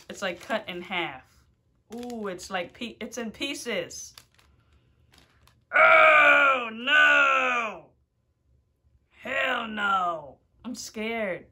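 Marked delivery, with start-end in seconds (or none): 5.7-10.4 loud, high panicked shout; frightened shout. Real, not acted. Vlogger seeing the dark chip, frightened shout. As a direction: yell it out in real fear.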